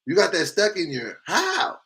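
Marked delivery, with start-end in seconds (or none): 1.2-1.8 high-pitched incredulous question; baffled disbelief. Real, not acted. Streamer questioning a viewer's confession, baffled disbelief. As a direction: shoot the word way up in disbelief.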